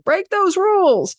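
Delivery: high, slow, carefree goddess cry; Venus breaking rules. Retold or imitated. Venus urging you to break rules, high and slow. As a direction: toss the rules away, drawn out and high. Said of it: "She's like".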